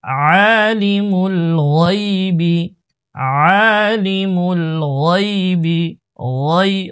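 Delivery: slow recitation, ওয়াই said with a full, thick ghayn. Shown as a demonstration. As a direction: draw out আলিমুল, then a heavy ghayn in ওয়াইবি. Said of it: "ওয়াইনের উচ্চারণ মোটা". (ওয়াইবি-র গাইন মোটা করে তিলাওয়াত)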